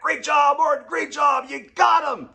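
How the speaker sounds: very high, loud triumphant shout; gloating. Retold or imitated. Father-in-law congratulating Martin, loud and triumphant. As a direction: cheer the win.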